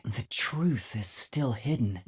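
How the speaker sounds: ordinary talk, nothing marked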